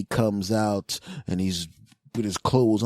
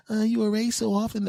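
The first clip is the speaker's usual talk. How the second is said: high whiny excuse; 13 semitones higher than usual. Retold or imitated. Girlfriend excuse voiced.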